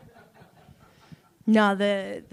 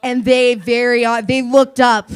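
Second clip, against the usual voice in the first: louder, pushed storytelling; loud punchline build; 3 semitones higher, 11 dB louder than usual. Real, not acted. Comic telling crowd of awkward moment, loud and pushed. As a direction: belt out the awkward reveal.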